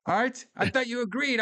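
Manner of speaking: high-pitched, indignant mock outburst; mock pissed off. Host imitates Walken's character mad at the computer, mock pissed off. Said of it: "he gets all pissed out about it".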